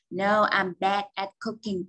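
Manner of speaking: said slowly and clearly; slow model answer. Shown as a demonstration. Teacher models a sample answer slowly. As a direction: read the answer slowly for the class. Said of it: "Ví dụ".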